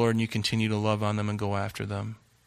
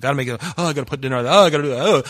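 frantic rush; 3 semitones higher, 7 dB louder, much faster than usual. Retold or imitated. Frantic busy person rushing.

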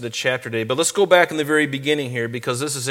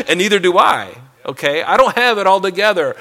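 loud laughing confession; 6 semitones higher, 10 dB louder than usual. Real, not acted. Confessing own shortcomings with a laugh.

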